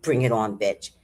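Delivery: the cat squaring up, low and tough. Retold or imitated. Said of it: "She was like".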